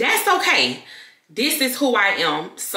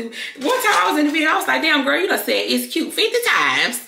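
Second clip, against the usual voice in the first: higher-pitched retold self-scolding; animated, raised-pitch retelling; mock exasperation; 9 semitones higher than usual. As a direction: exclaim it in mock disbelief at yourself. Acts out own exasperated reaction.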